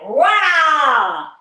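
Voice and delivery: loud, high release cry; loud release shout. Shown as a demonstration. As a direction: let the stress go with a shout. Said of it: "and you make like".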